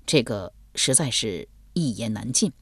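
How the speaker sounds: low, soft, awkward reply; reluctant evasion. A narrator's reading. Kongkong'er unwilling to lie to Yuqing, reluctant low evasion. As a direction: dodge the question in a subdued voice. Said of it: "又不愿意说谎".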